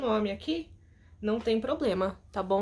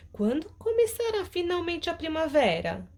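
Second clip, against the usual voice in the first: put-on a child impatient for spring; pleading, discontented little girl; 7 semitones higher than usual. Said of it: "Ela, descontente".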